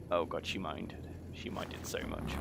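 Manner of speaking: low, very soft aside; hushed dismay. Real, not acted. Gamer realising she minded so much, hushed dismay. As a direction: drop right down, almost whispering by the end.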